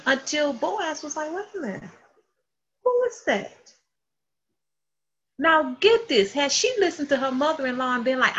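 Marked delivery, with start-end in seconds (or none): 1.3-3.7 acted surprised noticing. Boaz spots an unknown woman gleaning, high hushed surprise.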